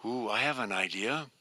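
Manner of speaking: ordinary delivery, nothing marked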